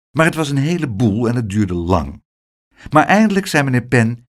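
ordinary delivery

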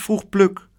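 plain reading voice

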